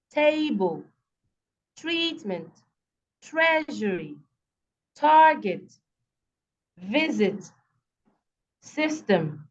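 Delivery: a word list, each of table to surgery stressed on its first syllable, then falling. Shown as a demonstration. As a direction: punch TA in table, TREAT in treatment, and so on to SUR in surgery. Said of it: "stress in their first syllable".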